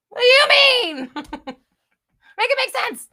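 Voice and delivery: high, loud, then slowed through laughter; drawn-out "make it make sense" through a laugh; laughing disbelief. Retold or imitated. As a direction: crack up mid-rant. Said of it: "It's like".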